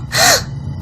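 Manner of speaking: quick breathy gasp of shock; sharp gasp. Acted. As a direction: gasp in alarm. Girl shocked at eviction.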